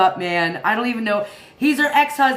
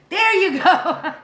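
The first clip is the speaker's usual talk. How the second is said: higher and slower, laughing through it; 9 semitones higher, 4 dB louder, slower than usual. Real, not acted. Streamer repeats chat's answer, laughing. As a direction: let the laugh take over the words.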